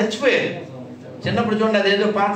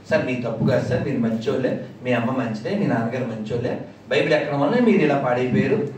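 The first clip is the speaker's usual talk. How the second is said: mock pious caller; 7 semitones lower than usual. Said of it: "అని చెప్పి".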